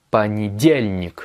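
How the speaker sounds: понедельник drawn out, heavy stress on the third syllable дель; stress on DYEL. Shown as a demonstration. Teacher showing the tonic accent, понедельник stressed on дель. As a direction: punch and stretch the дель in понедельник. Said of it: "l'accent tonique, il est sur le diel".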